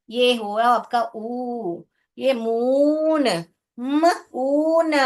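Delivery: मून with a drawn-out long ऊ, split म ऊन; long u sound. Shown as a demonstration. Teacher showing pupils the long oo sound. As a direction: stretch the vowel in मून slowly. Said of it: "उ करके उच्चारण करना है".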